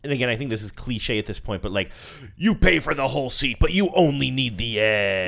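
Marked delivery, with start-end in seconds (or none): 2.4-5.3 lightly voiced monster truck ad. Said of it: "there was one that would say".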